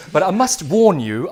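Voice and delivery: but I must WARN you; stress on warn. Acted. Teacher warns learners.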